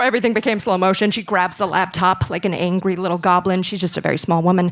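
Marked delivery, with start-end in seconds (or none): none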